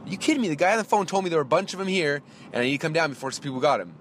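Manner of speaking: high-pitched, incredulous retold protest; getting angry. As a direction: protest in disbelief, pitch leaping up. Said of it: "so I'm starting to get".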